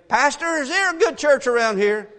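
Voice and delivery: high, loud, mock-innocent question; mock naive asker. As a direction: play the clueless churchgoer. Mocking young people's question.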